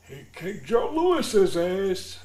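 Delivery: put-on high, loud and slow old man rasp. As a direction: ham up a cranky old-timer bragging. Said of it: "stereotypical old guy".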